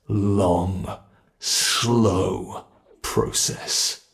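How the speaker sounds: ordinary talk, nothing marked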